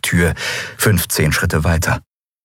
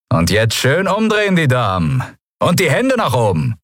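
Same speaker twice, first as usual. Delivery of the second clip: smug pirate; 6 semitones higher than usual. A narrator's reading. Pirate mocking captives.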